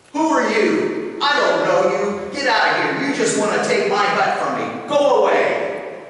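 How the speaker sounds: loud, high, furious shouting; angry shout. Acted. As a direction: shout him off your doorstep. Brother in hut drives him away.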